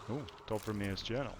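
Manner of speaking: ordinary delivery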